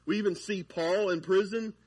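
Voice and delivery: normal talking voice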